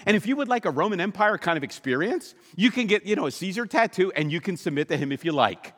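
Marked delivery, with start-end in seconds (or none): none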